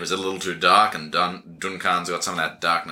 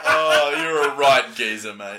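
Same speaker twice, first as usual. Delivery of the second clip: mock Cockney; 6 semitones higher, slower than usual. Echoing the Cockney bit.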